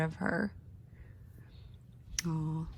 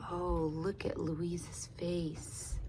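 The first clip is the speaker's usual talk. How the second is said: whisper; 9 dB quieter, slower than usual. A narrator's reading.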